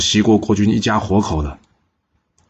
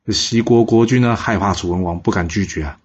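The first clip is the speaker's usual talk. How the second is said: ordinary delivery, nothing marked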